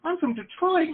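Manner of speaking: high, startled refusal; mock alarm. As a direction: recoil from the offer in a high voice. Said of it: "I'm like".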